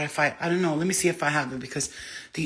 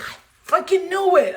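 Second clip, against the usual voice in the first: much higher, loud triumphant cry; excited exclamation; 12 semitones higher, 8 dB louder than usual. Retold or imitated. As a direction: burst out in triumph. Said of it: "I was like".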